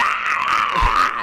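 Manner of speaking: loud put-on battle yell; hammed-up wrestler shout; mock Goldberg roar.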